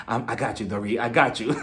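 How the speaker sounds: low, soft, deadpan bodyguard; low guard voice. Retold or imitated. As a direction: drop it low and quiet, stiff. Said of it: "she was just really standing next to her like".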